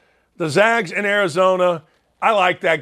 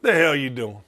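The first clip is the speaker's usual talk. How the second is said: lightly voiced dad, lower; a low, understated impression of a scolding dad; quoting his dad; 6 semitones lower, 5 dB quieter than usual. Host imitating his dad telling him off, low voice. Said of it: "To quote my dad".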